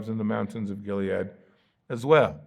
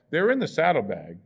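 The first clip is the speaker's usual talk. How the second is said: ordinary talk, nothing marked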